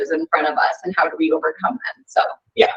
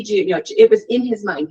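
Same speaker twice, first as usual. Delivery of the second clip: usual speaking voice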